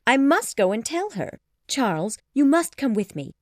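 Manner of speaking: high, loud and excited; eager urging. A narrator's reading. Jane urges her fiancé to come along, eager voice. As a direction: hurry him along with delight. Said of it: "cried Jane".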